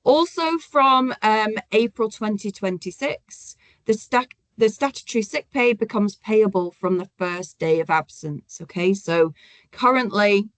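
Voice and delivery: ordinary delivery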